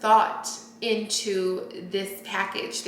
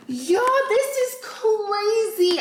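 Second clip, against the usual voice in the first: sharp high gasp, then a much higher cry; delighted gasp; 13 semitones higher than usual. Real, not acted. Finding the hot cocoa candle, gasping in surprise. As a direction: gasp and exclaim in real surprise.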